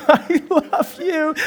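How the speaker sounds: high, slow strained whimper; mock-tearful forced declaration; reluctant child whine. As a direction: wail it as a kid under orders.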